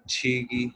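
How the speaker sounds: छी की said as an aspirated demo; pinyin q sound. Shown as a demonstration. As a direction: breathe out on छी की for the q initial. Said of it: "क्यू sound देता है". (क्यू की ध्वनि छी की बोलकर दिखाना)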